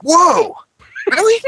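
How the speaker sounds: put-on very high, loud, astonished kid; amazed kid. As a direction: gasp it out like a thrilled child. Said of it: "I'm like".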